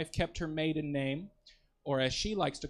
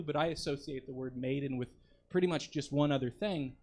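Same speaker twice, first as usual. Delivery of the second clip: ordinary talk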